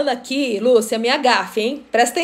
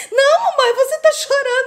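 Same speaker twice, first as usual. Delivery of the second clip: high-pitched imitation of a daughter; mock-exasperated child setting mom straight; daughter correcting mom; 12 semitones higher than usual. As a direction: insist in a higher, daughterly voice. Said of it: "Aí ela falou assim".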